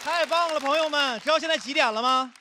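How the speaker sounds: high-energy MC welcome; host hype. Real, not acted. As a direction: pump up the room. Hyping crowd opening new set.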